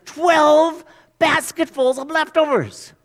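slow, high and loud with wonder; amazed. Real, not acted. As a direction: marvel at the abundance.

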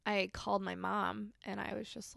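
ordinary talk, nothing marked

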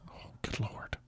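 barely voiced, whispered groan of disbelief; exasperated aside. Real, not acted. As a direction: mutter it under your breath, very softly. Reader's exasperated aside on text.

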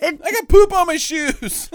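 high, loud comic whine; mock wailing complaint. Attacker complaining about dirty shoes, high mock wail. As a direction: cry out in hammed-up disgust.

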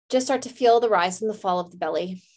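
calm meditation voice with long pauses; guided meditation. Real, not acted. Host leads listeners to a candle flame, calm meditation. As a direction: guide them gently, leaving space to breathe.

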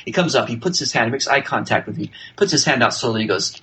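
ordinary delivery

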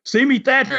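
high, gloating heel's taunt; mock Carson taunt. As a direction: toss it off like a cocky wrestler showing off. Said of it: "he says".

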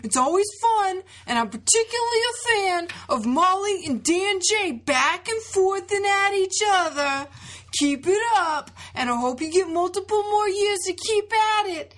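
put-on high, loud Southern accent. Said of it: "read this in a Southern accent".

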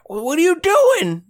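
put-on high squeaky old sailor voice; gruff old fisherman. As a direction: bark it out like a salty old sea dog. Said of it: "old Salty Jim is like".

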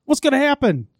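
high mock panic over the toxic waste; panicked viewer. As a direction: squeak it out like you just spotted the toxic waste. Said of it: "I was like".

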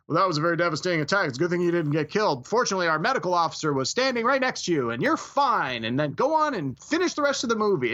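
rattled off fast, mock officer explaining; fast mock movie dialogue. Mocking the lazy movie rescue scene, rattled off fast. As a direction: zip through it like a cheesy script. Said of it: "he's like".

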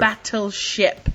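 slow, stretched BATTLESHIP; drawn-out title. Pretend. Guest echoing the film title, slow and drawn out. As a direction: draw BATTLESHIP out across both halves. Repeats title in announcer manner.

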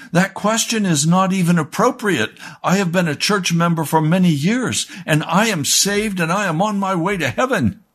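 high-pitched mock indignation, pitched well up; imitating the furious church lady, not actually shouting; hammed-up offended woman, high and huffy; retold angry outburst. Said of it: "she began to scream at me".

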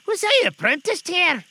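very high, loud exclamation; high-pitched delighted outburst; excited recognition. A narrator's reading. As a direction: cry out as Scrooge, thrilled to know the place. Scrooge recognises the warehouse.

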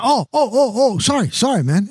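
high, hurried mock apology; startled, apologetic reenactment; flustered apology. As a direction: act out scrambling to apologize. Acts out flustered apology to yellers.

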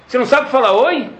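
pergunta aguda de repreensão; você não sabe falar oi, cobrado agudo e indignado; Hashem indignado. Retold or imitated. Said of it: "Hashem falou". (Hashem repreende Moshe, voz aguda e cobradora)

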